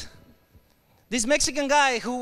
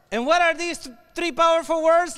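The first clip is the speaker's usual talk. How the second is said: ordinary talk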